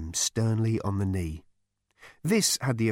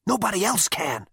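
plain reading voice

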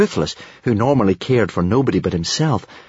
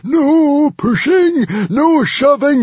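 very high screams broken by mad laughter; screaming hysterically; 6 dB louder, slower than usual. A narrator's reading. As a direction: shriek slow, stretched-out orders while cackling helplessly. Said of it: "laughing and itching and screaming hysterically".

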